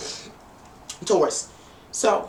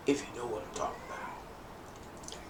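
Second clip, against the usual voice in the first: whispered knowing aside; hushed innuendo; 7 semitones lower, 13 dB quieter than usual. Real, not acted. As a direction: drop to a whisper, hinting at something naughty. Hushed innuendo about adult subject.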